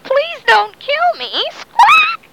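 high squawking bird voice, slowed down; parrot voice. Acted. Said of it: "and it said".

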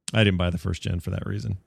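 ordinary talk

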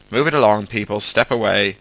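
loud, firm crowd command; calling out. Acted. Guard moving mall workers away, loud calling out. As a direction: push the crowd back by voice.

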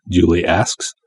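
usual speaking voice

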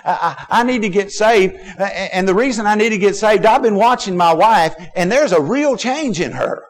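high, impassioned retold confession; earnest urgent plea. Husband telling the preacher about his changed wife, earnest urgent plea.

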